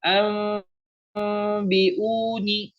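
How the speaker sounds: "Am, biuni" with lips a paper's width apart on a long m; slow chanted "Am, biuni", veiled m; furjah, slight lip gap. Shown as a demonstration. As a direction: hum the m of "Am" without closing the lips. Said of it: "dibuka selai kertas".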